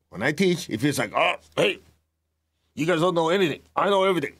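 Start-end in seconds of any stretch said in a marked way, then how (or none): 1.1-4.3 higher, smug and superior; mock arrogant teacher lecturing students; know-it-all tone. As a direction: lord it over the class. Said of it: "it's the tone you said it".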